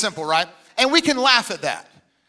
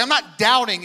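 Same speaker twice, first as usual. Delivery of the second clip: ordinary delivery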